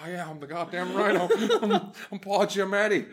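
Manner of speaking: laughing impression of Paul Giamatti; a light, laughing Giamatti boast. Cohost riffs on the Rhino, laughing Paul Giamatti impression. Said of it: "That's a pretty good Paul Giamatti".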